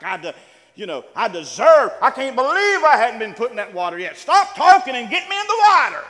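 hammed-up, loud, high indignant demand; arrogant outburst. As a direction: play a proud man yelling his entitlement. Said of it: "instead of a proud, arrogant, like".

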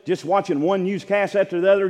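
normal talking voice

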